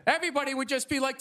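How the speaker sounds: usual speaking voice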